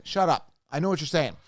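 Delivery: ordinary talk, nothing marked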